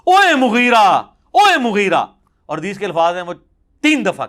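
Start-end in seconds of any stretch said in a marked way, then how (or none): 0.0-2.0 retold angry shout, louder and higher; angry call. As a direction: call out the name in anger. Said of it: "غصے میں کہتے ہیں". (غصے سے پکار کر)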